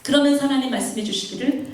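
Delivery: ordinary talk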